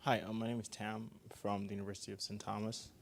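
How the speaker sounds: faint and distant, mic held away; mic held too far. Real, not acted. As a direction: speak well away from the microphone. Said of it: "Hold the mic closer so we can hear you".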